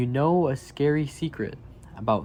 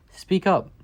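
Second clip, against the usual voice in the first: louder and higher, a rousing call; emphatic call; 7 semitones higher, 5 dB louder than usual. Acted. Reading to children, rallying them to speak up with a loud call. Refrain urging kids to speak.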